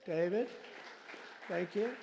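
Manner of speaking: ordinary talk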